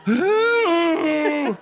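long, high, loud mock cry; tearful wail. A narrator's reading. Chris begging her sister to answer, wailing. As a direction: howl it, hammed-up tears. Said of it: "letting the tears run down her cheeks".

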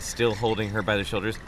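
ordinary delivery

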